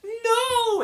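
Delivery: high, drawn-out melodramatic wail; hammed-up cry of grief; mock anguished cry. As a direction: parody a movie scream of loss. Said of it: "she drops to her knees and she's like".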